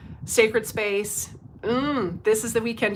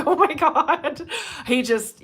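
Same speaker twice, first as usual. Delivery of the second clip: laughing; 6 semitones higher, 4 dB louder, slower than usual. Real, not acted. Reacting to comedian clip.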